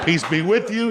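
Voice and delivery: high mock church greeting, laughing; churchgoer greeting. Comic telling friends about church, mock churchgoer greeting. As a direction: play a cheery churchgoer.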